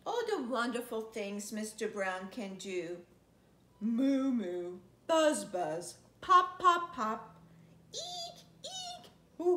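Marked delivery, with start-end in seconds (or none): none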